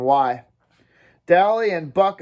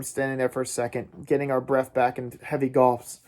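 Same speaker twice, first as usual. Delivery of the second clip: ordinary delivery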